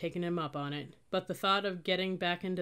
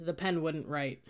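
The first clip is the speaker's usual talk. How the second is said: usual speaking voice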